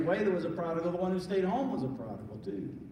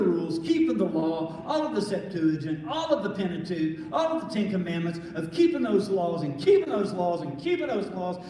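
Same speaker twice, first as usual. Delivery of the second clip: louder, driving sermon build; preaching; 5 dB louder than usual. Acted. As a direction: hammer each list item like a pulpit climax.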